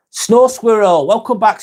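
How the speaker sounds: greeting a returning viewer warmly by name; excited greeting. Real, not acted. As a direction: call the name out happily.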